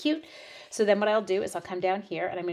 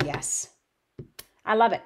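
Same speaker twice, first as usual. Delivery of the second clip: usual speaking voice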